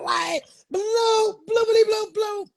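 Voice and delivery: high laughing bloops of car bass; imitated bass thump. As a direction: mimic the booming speakers, cracking up. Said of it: "blowing like".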